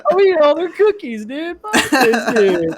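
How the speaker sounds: very high, hammed-up tearful wail; mock crying. Worker raiding cookies out of spite, mock crying wail. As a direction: wail it loud and high, pretending to sob. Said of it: "I was crying".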